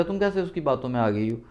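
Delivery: ordinary delivery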